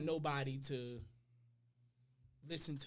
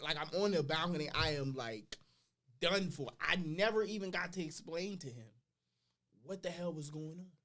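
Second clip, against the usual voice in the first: normal talking voice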